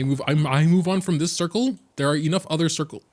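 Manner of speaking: excited. Real, not acted. Excited gaming outburst.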